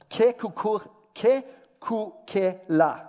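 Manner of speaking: "Kekukela" sounded out slowly, one syllable at a time; syllable-by-syllable pronunciation. Shown as a demonstration. Preacher teaching the room an old brand name, "Kekukela" spelled out slowly by syllable. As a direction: break "Kekukela" into slow, separate syllables. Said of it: "was first pronounced".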